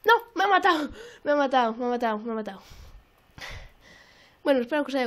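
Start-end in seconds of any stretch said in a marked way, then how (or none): 0.0-2.6 dismayed shout. Real, not acted. Just killed in the game, loud dismayed cry. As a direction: shout it in frustration.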